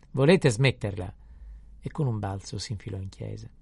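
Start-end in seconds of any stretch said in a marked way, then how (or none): 0.1-1.1 loud, slow reprimand; raised priestly scolding; scolding call. Acted. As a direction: call out sternly to unruly children. Priest scolds unruly children.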